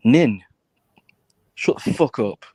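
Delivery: ordinary delivery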